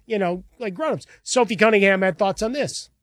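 usual speaking voice

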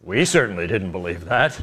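low, soft mocking echo; mocking Scott. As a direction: drop low and quiet to mock him. Host mocks Scott's line.

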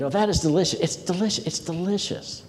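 high, softening delighted repeats; intensifying repetition. Acted. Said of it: "You'd repeat that phrase again".

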